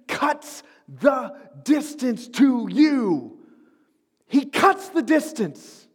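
loud, high, building sermon climax; full-force preaching. Acted. Preacher thundering to the church about Jesus, loud and rising. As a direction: preach it out over the music, rising.

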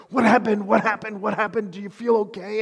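high, urgent panic, firing off questions; frantic parent checking on a hurt kid; panicked. Retold or imitated. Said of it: "panic and fight mode".

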